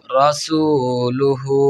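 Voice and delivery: stretched reading of রসুলুহু; slow recitation. Shown as a demonstration. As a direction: draw out রসুলুহু with long vowels. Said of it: "demo of joined reading".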